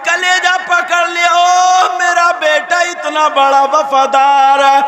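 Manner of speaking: high, loud, slow wailing sermon half sung; tearful preaching. Acted. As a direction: preach the son's grief at full force. Preacher at full force. (ऊँची, धीमी, रोती हुई तक़रीर)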